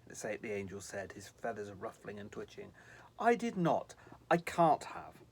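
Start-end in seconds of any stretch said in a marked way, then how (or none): 3.2-5.2 stammered. Acted. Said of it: "Sylvie stammered".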